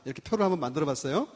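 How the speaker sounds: ordinary talk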